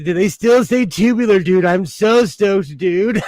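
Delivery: loud laid-back California dude; surfer dude voice. Shown as a demonstration. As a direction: stretch it out like a surfer. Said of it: "people seriously, uh, talk like that".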